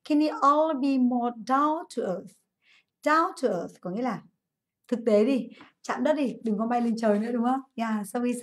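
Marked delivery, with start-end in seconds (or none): 0.0-2.4 slow, careful reading of the sentence; slow model sentence. Shown as a demonstration. Teacher slowly reads the example sentence to students. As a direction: read it out slowly for the class. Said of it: "Cô [name] nhá".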